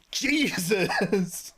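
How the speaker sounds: high laugh through the word; laughing exclamation. Real, not acted. Streamer laughing at her own panicked impression.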